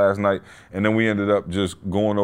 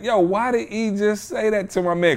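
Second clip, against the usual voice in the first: mock disbelief; 8 semitones higher than usual. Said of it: "I was like".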